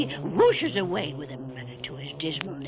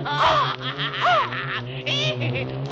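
wild cackle; 3 semitones higher, 3 dB louder than usual. Acted. Keeper triumphant over the monster, wild cackle. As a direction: burst into a crazed shrieking laugh.

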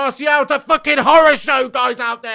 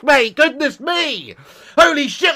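normal talking voice

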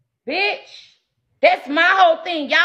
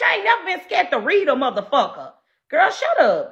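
ordinary delivery

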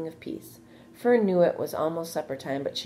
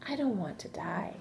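small, soft, higher frightened voice; fearful plea; 4 semitones higher, 5 dB quieter than usual. A narrator's reading. Wilbur telling Charlotte his fear of death, softly afraid. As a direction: confess a fear quietly.

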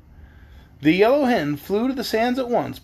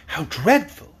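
put-on mildly shocked girl; a touch of horrified disbelief; slower than usual. A narrator's reading. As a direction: exclaim it in understated shock. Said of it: "exclaimed Dorothy in a shocked voice".

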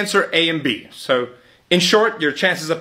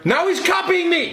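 put-on very high whiny kid complaint; whiny tattling little sibling; 12 semitones higher than usual. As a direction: whine it to dad. Kid tattling, then mocked.

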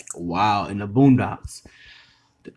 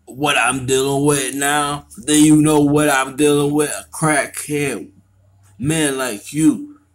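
loud, raised-pitch yelling; yelling at the crackhead who owes money; angry shout; 6 semitones higher, 8 dB louder than usual. Acted. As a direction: shout him down in anger. Heated argument over drug money.

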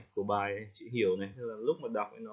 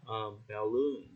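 normal talking voice

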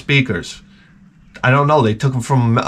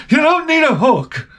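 much higher, dismissive wife voice; shrill mock wife. Husband mimics wife waving off his hook request, shrill. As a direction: brush him off in a high squeal. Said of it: "wife dismisses the need".

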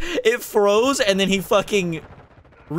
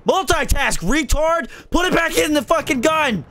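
heated rant; 7 semitones higher than usual. Real, not acted. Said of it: "got a little heated there".

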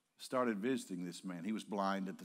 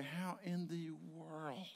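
soft, slow, higher-pitched incredulity; hushed disbelief; 6 semitones higher, 5 dB quieter, slower than usual. Retold or imitated. Preacher voicing listeners' question about the burned girl, softly incredulous. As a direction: draw the question out softly, amazed.

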